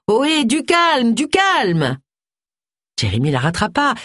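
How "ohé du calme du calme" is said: slow, higher drawn-out call to calm her. A narrator's reading. Guest calls after Agatha as she leaves, a slow high call. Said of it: "au cri de".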